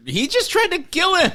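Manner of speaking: high-pitched retold outrage; mock indignant protest. Voicing what she could have said, high indignant protest. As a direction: protest in high, hammed-up disbelief. Voices her imagined protest.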